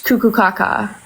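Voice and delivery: ordinary delivery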